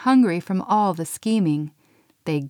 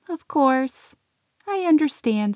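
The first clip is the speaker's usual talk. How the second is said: higher, meek elf voice; subdued elf voice; 7 semitones higher than usual. A narrator's reading. Pixie answering Santa, a touch of meek elf voice. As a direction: meekly accept it.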